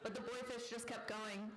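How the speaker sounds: ordinary delivery, nothing marked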